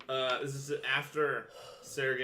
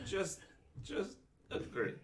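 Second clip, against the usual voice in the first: soft dismissive; 4 semitones higher, 8 dB quieter, slower than usual. Acted. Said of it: "wave his hand and just go".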